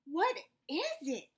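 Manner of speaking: eager question. Acted. Amu asks eagerly.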